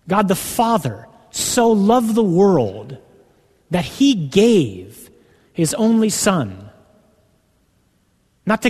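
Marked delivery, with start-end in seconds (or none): 0.1-6.7 slow, higher, the Father drawn out; emphasis on the Father. Shown as a demonstration. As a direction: stretch out the Father slowly. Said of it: "every time you see God in the New Testament, it's Father".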